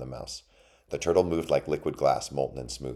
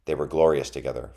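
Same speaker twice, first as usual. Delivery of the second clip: usual speaking voice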